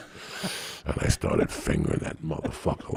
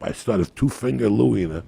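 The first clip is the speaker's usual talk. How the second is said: ordinary talk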